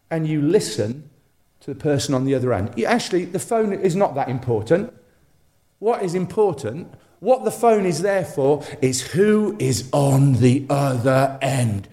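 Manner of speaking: normal talking voice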